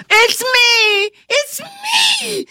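very high, joyful shouting; exultant shout. Acted. Caleb, human again, shouting exultantly. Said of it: "he shouted exultantly".

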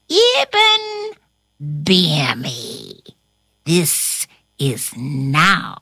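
slow witch's incantation, mostly low; wheezed spell. A narrator's reading. Witch casting on the sleeping carpenter, wheezed incantation. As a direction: draw the spell out with long pauses. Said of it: "wheezed out these words".

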